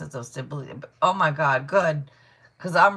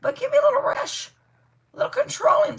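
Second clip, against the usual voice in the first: very high, put-on squeaky voice, a little louder; mock squeaky voice; 6 dB louder than usual. Reader mocking a client who wants control, squeaky mock voice. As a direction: squeak it out like a bossy caricature.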